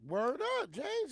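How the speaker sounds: high, loud mock voice of the driver; imitated driver. Retelling the driver who ran them off the road, high mock taunt. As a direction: ham up the other driver's taunt.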